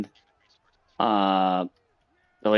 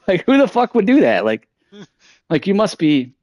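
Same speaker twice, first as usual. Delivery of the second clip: much higher, louder, exasperated rant; indignant outburst; 8 semitones higher, 4 dB louder than usual. Real, not acted. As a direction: blow up in disbelief at the coworker. Ranting about coworker calling HR.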